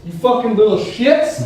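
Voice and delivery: retold furious telling-off; livid yelling. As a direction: scold like a livid scoutmaster. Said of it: "like, livid, just yelling at us".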